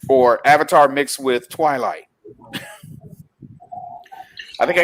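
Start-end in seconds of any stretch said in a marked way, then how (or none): none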